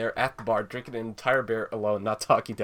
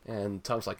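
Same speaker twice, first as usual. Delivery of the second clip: usual speaking voice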